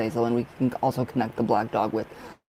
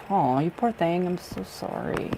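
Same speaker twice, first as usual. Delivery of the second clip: soft, higher, whispered sympathy; sympathetic; 5 semitones higher, 5 dB quieter, slower than usual. Real, not acted. Consoling Lady Blind Wolf over her loss, tender. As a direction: comfort her gently. Comforting viewer who lost something.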